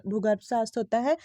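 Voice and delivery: usual speaking voice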